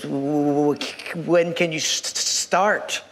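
put-on low, stammering old man; shaking elderly man. Old shop owner to job seeker, shaky stammer. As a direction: stutter haltingly on the first sounds. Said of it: "this man is kind of shaking".

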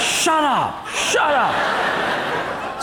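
high-pitched mock shout; hammed-up strained outburst; exasperated husband. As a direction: silence your wife in a comic panic. Husband silences wife in joke.

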